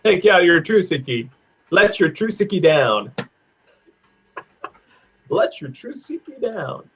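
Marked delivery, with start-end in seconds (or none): 3.2-6.9 high, soft words through laughter; real giggly, softer high voice; laughing. Real, not acted. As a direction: repeat it while cracking up. Laughs while repeating the phrase.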